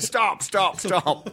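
high mock stop; imitating director. Actor retells director halting his bad accent, high mock stop. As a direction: pitch it up as the director cutting him off. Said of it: "he just went".

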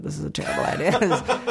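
laughing. Retold or imitated. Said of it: "my husband and I, like".

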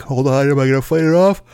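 talking through a yawn; words stretched by a yawn. Real, not acted. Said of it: "I'm gonna yawn".

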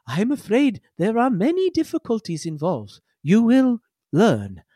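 a cryptic warning before leaving; ominous bot. Acted. Bot leads them out.

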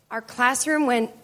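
ordinary talk, nothing marked

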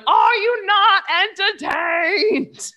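high, stretched-out gladiator shout; theatrical movie-hero bellow; Russell Crowe impression. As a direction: call it out grandly to a crowd. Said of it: "He's looking around going".

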